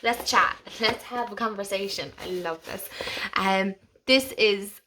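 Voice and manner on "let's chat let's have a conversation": eager invitation. Real, not acted. Eager call to viewers.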